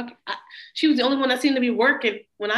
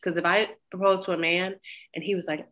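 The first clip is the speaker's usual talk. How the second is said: ordinary talk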